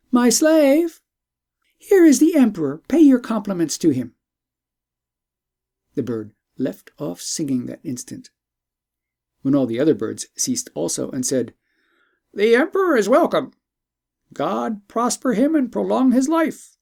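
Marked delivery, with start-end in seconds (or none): 0.1-4.1 calling out high and loud to the bird; raised voice. Acted. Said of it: "the princess raised her voice and said". 12.3-16.8 high, louder bird voice; a magical bird greeting royalty grandly; talking bird. Acted.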